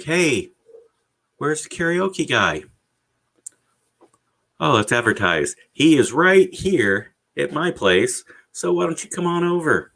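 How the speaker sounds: mock customer voice. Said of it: "The ones are like".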